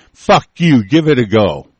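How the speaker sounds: loud, defiant challenge; angry dare. Real, not acted. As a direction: hurl it loudly as a furious dare. Dares his opponents in anger.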